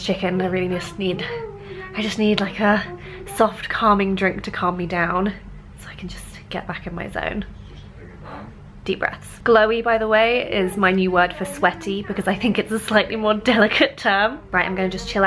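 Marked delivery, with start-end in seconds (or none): 5.8-9.4 whispering. Real, not acted. Calming down, taking deep breaths.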